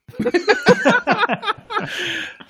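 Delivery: squeaky laughing fit; high burst of laughter. Real, not acted. Laughing at own alien voice, high giggle. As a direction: breaks up laughing.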